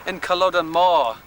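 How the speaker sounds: hammed-up Scottish accent, tour guide bluster; mock Scottish accent. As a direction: play a jokey Scottish guide at the battlefield. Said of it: "Why are you speaking in a Scottish accent?".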